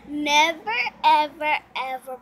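Never and ever called out loud; emphatic loud NEVER. Real, not acted. Girl warning her uncle about girls, loudly emphatic. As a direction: hammer home Never, ever.

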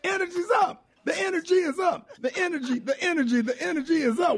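high-pitched excited chanting; hyped-up energy. Pretend. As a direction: hype the room up, pitched way high. Hyping up the studio's energy.

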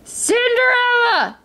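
high, drawn-out summons; stepmother's call. A narrator's reading. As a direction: holler for her, stretching the name. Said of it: "cried the stepmother".

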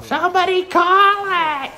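very high, shrill-pitched stands parent; mock parent yell. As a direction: imitate a parent shouting from the bleachers. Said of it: "someone from the stands yell".

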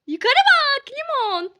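loud, high, slow call upward; excited call. Real, not acted. Vlogger calls to Limon to look up at the vault, loud high call.

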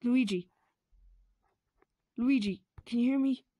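loud, urgent call to him. Acted. Mario calling loudly to his collapsed brother. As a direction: shake him awake with your voice.

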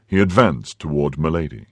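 usual speaking voice

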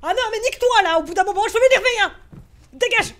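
loud, high, fast scolding; rattled angry outburst. Real, not acted. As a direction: lash out loudly after a scare. Scared player snaps at the game.

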